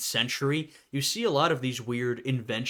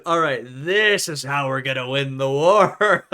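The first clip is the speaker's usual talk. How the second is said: louder mock German officer; 7 dB louder than usual. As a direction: boom it like a scheming general. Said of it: "They said".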